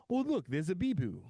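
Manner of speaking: chirpy commercial line; mock ad voice. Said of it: "So he calls him".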